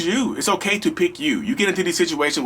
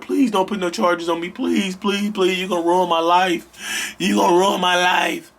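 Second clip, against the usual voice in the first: mock pleading abuser voice; hammed-up begging, please please; 3 semitones higher than usual. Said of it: "if you let them talk you out of it".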